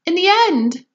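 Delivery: high loud shriek. Acted. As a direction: shriek it frantically. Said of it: "shrieked Missus Salt".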